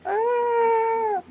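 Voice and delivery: very high short squeal. Real, not acted. Host laughing at his own crude joke, high squeal. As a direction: squeal with embarrassment.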